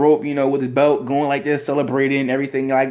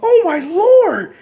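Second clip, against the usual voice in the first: high, loud, drawn-out cry of shock; commentator's stunned wail; Michael Cole; 7 dB louder, much slower than usual. Acted. Commentator seeing a friend attacked, aghast. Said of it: "you can hear Michael G-, Cole going".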